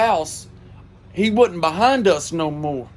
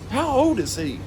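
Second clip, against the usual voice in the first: puzzled neighbor; 7 semitones higher than usual. Retold or imitated. Karen's puzzled question.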